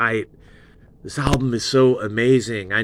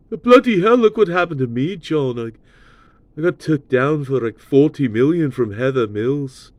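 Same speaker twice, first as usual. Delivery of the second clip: [name] impression; 4 semitones higher than usual. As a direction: ham up a grumbling Beatle, quick on the middle. Paul voiced complaining to John.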